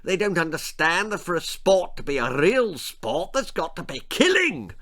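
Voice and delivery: high-pitched, indignant lord; peevish aristocratic grumbling; snappish. A narrator's reading. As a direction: complain crossly, pitch swooping up. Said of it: "he snapped at his wife".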